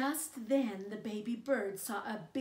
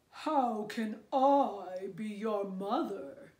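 slow, louder lowing cow voice; 8 dB louder, slower than usual. Acted. Cow answering the baby bird, slow louder cow voice. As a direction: drag it out like a cow. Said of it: "said the cow".

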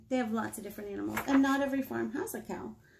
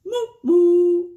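mooing cow; 8 semitones higher, 15 dB louder than usual. Pretend. Cow drives off fast.

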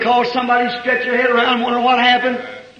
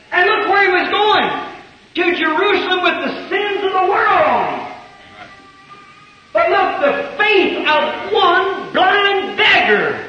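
preaching at full force; 6 semitones higher than usual. Acted. Preacher telling the congregation faith stops Jesus, fervent preaching. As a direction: lift the crowd with the climax.

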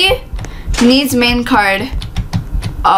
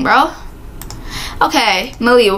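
ordinary talk, nothing marked

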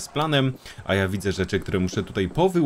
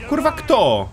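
startled outburst; 11 semitones higher, 3 dB louder, slower than usual. Real, not acted. Player spotted again mid-mission.

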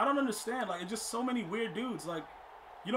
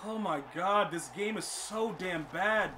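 ordinary talk, nothing marked

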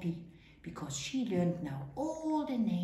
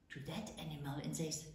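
ordinary delivery

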